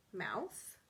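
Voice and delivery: usual speaking voice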